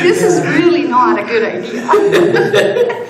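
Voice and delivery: high, loud, slow mock protest while laughing; mock dismay, laughing. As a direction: stretch it out in amused fake alarm. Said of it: "I'm like".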